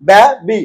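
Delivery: ব্যাবি slowly in two separate beats; split into syllables. Shown as a demonstration. Teacher showing a two-syllable word, ব্যাবি said syllable by syllable. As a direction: break ব্যাবি after ব্যা, pause, then বি. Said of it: "ডাইসিলেবলের মধ্যে পড়ছে".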